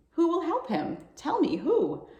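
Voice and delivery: usual speaking voice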